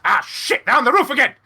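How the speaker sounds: acted exclamation of dismay; alarmed Lord Mayor. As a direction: cry out on spotting intruders overhead. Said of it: "He looks up and goes".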